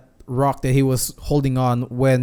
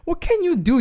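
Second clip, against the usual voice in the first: squeaky high mock jab at the captain. Streamer teases the captain who has no powers, mocking. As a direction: scoff at him in a silly high voice.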